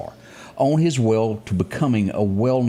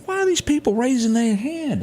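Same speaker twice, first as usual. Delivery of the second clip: puzzled; 12 semitones higher than usual. Retold or imitated. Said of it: "I'm looking around going".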